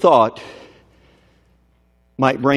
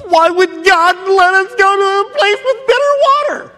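much higher, louder pleading question; preaching cry; 4 dB louder than usual. Real, not acted. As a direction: fling the question up to heaven.

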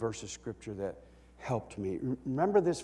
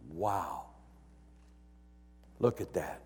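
ordinary talk

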